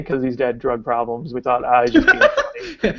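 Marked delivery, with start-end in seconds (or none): 1.6-3.0 high-pitched laughing words; words breaking up into high laughter. Real, not acted. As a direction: laugh right through the line. Laughing while retelling a misjudgement.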